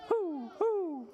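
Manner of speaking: put-on high owl hoots for children to copy; owl hooting. Shown as a demonstration. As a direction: hoot like a storybook owl. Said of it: "say that like owl".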